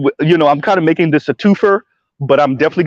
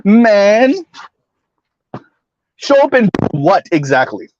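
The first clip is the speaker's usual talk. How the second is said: exasperated shout; 5 semitones higher than usual. Real, not acted. Exasperated reaction to comment.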